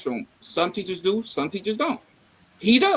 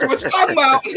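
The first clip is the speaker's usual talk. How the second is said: high, loud, laughing disbelief; incredulous yell; 8 semitones higher, 8 dB louder than usual. Retold or imitated. Father repeats his outraged question to his daughter, loud and high. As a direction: hammer the question at her.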